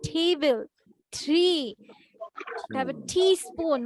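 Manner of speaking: forced hard t on "table", "tree", "টিস্পুন"; over-pressurized T. Shown as a demonstration. Teacher mimicking learners who force their words, over-pressed t in "table", "tree", "টিস্পুন". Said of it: "জোরপূর্বক ওই ওয়ার্ডটা".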